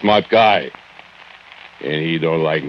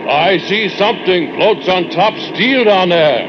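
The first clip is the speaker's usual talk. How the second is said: high, sustained holler; calling out; 3 dB louder than usual. Acted. Worker luring the foreman to look down, high shouted call. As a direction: shout it down over the roar. Calls to foreman over foundry noise.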